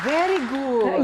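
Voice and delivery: slow, high, loud mock teacher praise; teacher's praise. Host imitating the teacher praising her accent, slow and loud. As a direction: play the impressed teacher, stretching it out. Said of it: "Sabi ng teacher".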